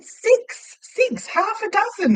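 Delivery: very high, emphatic retelling; exasperated repetition. Retold or imitated. Customer repeating an order to baffled staff, exasperated. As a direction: spell it out in high-pitched mock exasperation.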